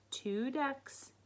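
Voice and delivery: ordinary delivery, nothing marked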